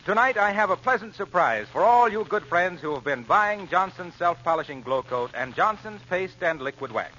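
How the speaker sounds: normal talking voice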